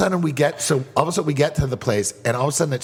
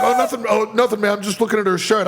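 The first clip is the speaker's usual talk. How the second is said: higher, nervous mock voice for a caught stranger; a guy caught staring; 7 semitones higher than usual. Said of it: "so he's like".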